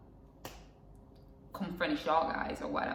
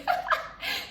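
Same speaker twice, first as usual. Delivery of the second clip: short, breathy burst of laughter; real unvoiced giggle; amused laugh. Real, not acted. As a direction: crack up briefly at your own slip. Amused at remembering forgotten point.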